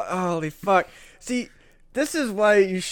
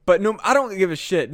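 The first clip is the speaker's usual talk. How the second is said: ordinary talk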